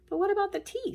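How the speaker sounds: lightly voiced child, pitched high; a hint of a worried small girl; little girl. Marilyn asks about the tea through her tears.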